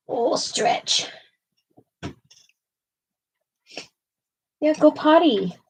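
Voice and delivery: sing-song doggy talk; pet talk. Real, not acted. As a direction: coax the dog to go out. Coaxing the dog.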